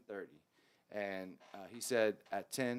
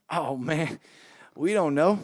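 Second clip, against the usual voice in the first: excited band mate; 6 semitones higher, 12 dB louder, slower than usual. Retold or imitated. Keys player laughs about no key.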